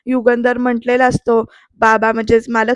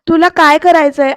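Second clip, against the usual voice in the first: louder, higher demand; angry challenge; 4 semitones higher, 5 dB louder than usual. Retold or imitated. Pruthvi challenging Aarti, angry and loud. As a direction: fling the question at her.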